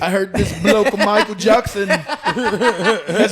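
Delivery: a hammed-up Liverpool Paul through laughter; British accent as Paul. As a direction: giggly fake British bloke voice. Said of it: "I wanna see Paul saying".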